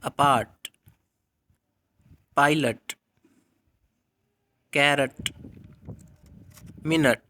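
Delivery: अपार्ट, पायलट with a weak uh schwa; teacher demo of schwa; schwa words. Shown as a demonstration. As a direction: say मिनट with a weak unstressed schwa. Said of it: "For example".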